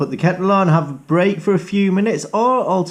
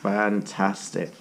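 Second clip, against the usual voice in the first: ordinary delivery, nothing marked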